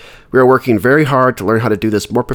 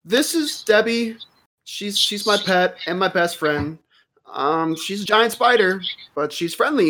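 put-on much higher, timid young halfling voice; nervous halfling; 10 semitones higher than usual. Halfling Quinn nervously introduces himself to the office woman, high timid voice. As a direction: squeak it out like a shy newcomer. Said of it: "gestures to the giant spider".

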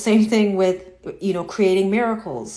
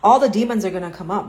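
ordinary talk, nothing marked

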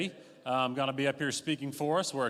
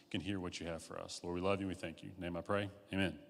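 rushed mumbled prayer; 5 semitones lower than usual. Real, not acted.

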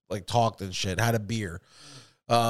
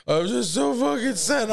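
high, wailing imitation of sadness; hammed-up wail, pitched way up; mock tearful; 11 semitones higher than usual. Bandmate apologizing on the phone, mock tearful wail.

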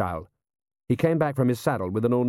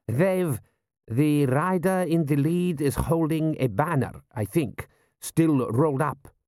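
slow, higher-pitched general's voice; observing general; 5 semitones higher, slower than usual. Acted. General peering through telescope reporting riders, halting.

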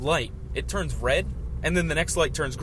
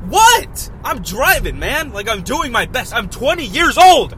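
much higher, much louder exasperated yell; retold shout; 8 semitones higher, 9 dB louder, slower than usual. Retelling his shout at a disgusted driver, exasperated yell. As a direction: shout back at the disgusted lady. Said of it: "I'm like".

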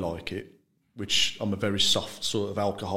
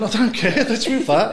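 higher, louder mock reply to mum; retold retort; 12 semitones higher, 7 dB louder than usual. He answers his mum about the tonic, a high loud retort. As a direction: toss it back loud and high, half laughing. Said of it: "I went".

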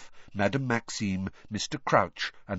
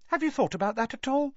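Rita Skeeter; faster than usual. A narrator's reading. Rita presses Harry.

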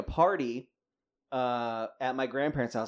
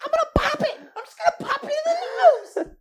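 put-on high, loud childish yell; kid yelling; 8 dB louder than usual. Little sister yelling at the bear, loud childish shriek.

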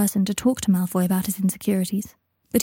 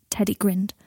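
usual speaking voice

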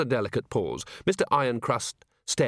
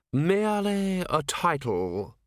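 slow, raised, cool precision; coolly dismissive, every syllable distinct; chilly, precise voice; 6 semitones higher, slower than usual. A narrator's reading. Ancient herald answers the commander, chilly. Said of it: "It was chilly, clipping every syllable with precision".

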